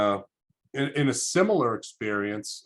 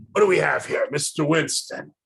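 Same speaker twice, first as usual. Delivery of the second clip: lightly voiced tough nun, louder opening; 5 semitones higher, 4 dB louder than usual. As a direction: size up a student like a tough counselor. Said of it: "she was a tough lady".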